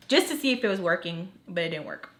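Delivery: normal talking voice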